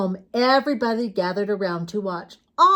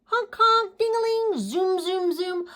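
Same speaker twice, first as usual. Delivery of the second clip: high playful honk and ding; reading bike noises to children, high and playful; 9 semitones higher than usual. Acted. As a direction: sing out the bike sounds.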